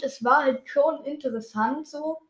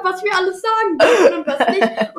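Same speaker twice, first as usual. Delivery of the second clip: stifled giggles; 7 semitones higher, 4 dB louder than usual. Real, not acted. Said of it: "Hör auf zu lachen".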